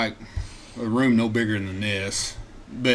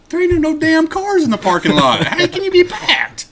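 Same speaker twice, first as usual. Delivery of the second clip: loud, very high mock outburst; outraged disbelief; 7 dB louder than usual. Imitating Bubba over the empty parking lot, outraged. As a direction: imitate a buddy hollering in high-pitched disbelief.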